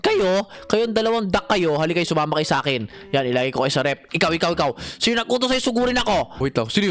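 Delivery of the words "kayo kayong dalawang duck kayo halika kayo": ordinary talk, nothing marked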